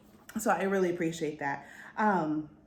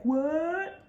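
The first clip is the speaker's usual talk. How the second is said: high, drawn-up "what"; hammed-up surprise; mock shock; 8 semitones higher, 3 dB louder than usual. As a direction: play up the disbelief. Reacting to Leo's return.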